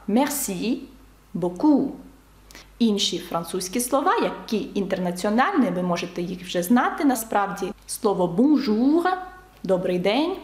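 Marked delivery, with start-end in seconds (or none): 0.1-1.9 "Merci" and "beaucoup" separated, each stressed at the end; final-syllable stress. Shown as a demonstration. Teacher repeating for learners, stress on each last syllable. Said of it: "наголос падає на останній склад". 8.3-9.1 "bonjour" with a drawn-out stressed -jour; final-syllable stress. Shown as a demonstration. Teacher showing a familiar French word, stress on -jour. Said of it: "теж наголос падає на останній склад".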